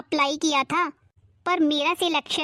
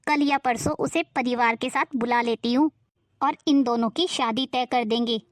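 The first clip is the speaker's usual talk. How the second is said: lower, harsh nagging aunt voice; a suspicious, scolding aunt pitched low; 4 semitones lower than usual. Acted. Aunt scolding Neha and her mother over the letter, in a lower nagging voice.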